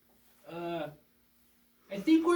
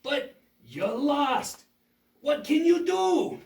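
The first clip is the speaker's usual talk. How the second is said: taunting villain; 5 semitones higher, 6 dB louder, slower than usual. Acted.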